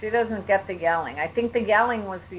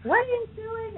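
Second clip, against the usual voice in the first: screaming mother. Retold or imitated. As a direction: imitate an outraged mother's high-pitched yell. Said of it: "started screaming".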